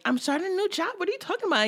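lightly voiced innocent newcomer; mock innocence; playing innocent. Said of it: "she was acting real like".

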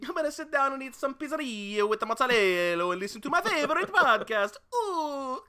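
high, whooping cartoon Italian; Mario. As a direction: go big and high with a hammed-up Italian accent. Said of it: "He does the Mario".

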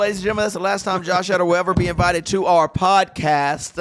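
high, laughing mock announcement. Real, not acted. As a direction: announce it grandly through laughter.

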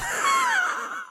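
high burst of laughter; real laughter. Real, not acted. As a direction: crack up after the headline. Laughs at the headline.

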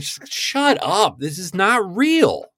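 high, slow, disbelieving mock complaint; drawn-out exasperated "shut up"; exasperated viewer. Viewer fed up with fake clean kids, high and slow. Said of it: "And you're like".

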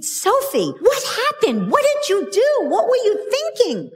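high, loud mock scolding; rimprovero materno imitato. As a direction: act out an exasperated mother yelling at her child. Mother scolds child in retold story.

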